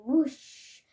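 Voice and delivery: a loud blowing whoosh; wind whoosh. Acted. Child reading a storybook, making the wind noise with a loud whoosh. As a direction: blow it out like a gust of wind.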